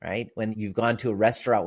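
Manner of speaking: usual speaking voice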